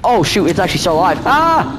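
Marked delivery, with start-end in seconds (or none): 1.3-1.8 startled yelp. Real, not acted. Startled by zombie.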